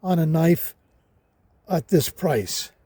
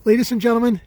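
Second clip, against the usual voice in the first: showman announcement; 5 semitones higher, 4 dB louder, much faster than usual. Acted.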